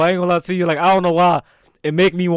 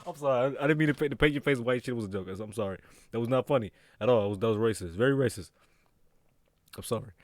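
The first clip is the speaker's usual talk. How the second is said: very soft, low apology; hushed and contrite; sheepish apology; 7 semitones lower, 12 dB quieter than usual. Real, not acted. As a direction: back down quietly, voice dropped low. Sheepish apology for his joke.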